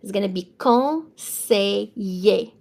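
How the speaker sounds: "conseiller" said slowly as "kon-say-yay"; teacher modelling conseiller; slow French model. Shown as a demonstration. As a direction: give "conseiller" slowly, double L as Y. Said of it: "Double L between two vowels is a Y sound".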